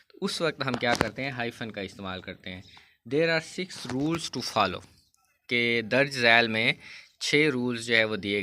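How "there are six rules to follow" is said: reads the rule slowly, word by word; slow textbook reading. Shown as a demonstration. Teacher reading the rule aloud to students, slow and deliberate. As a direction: dictate the rule slowly to the class.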